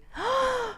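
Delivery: big high gasp of surprise. Real, not acted. Vlogger finding her old dream in the letter, high gasp. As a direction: gasp in disbelief. Reads YouTuber plan.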